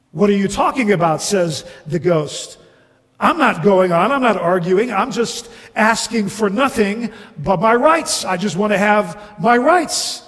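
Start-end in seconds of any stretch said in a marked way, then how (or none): 0.1-1.2 fast, louder retort; indignant ghost. Acted. Ghost challenging the bright man's rebuke, indignant. 3.2-10.2 louder, higher protest; aggrieved ghost. Acted. Ghost demanding his rights from the bright man, aggrieved.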